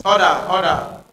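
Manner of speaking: loud call for order; shouting order order. Real, not acted. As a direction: shout over the room to restore order. Chair calling the house to order.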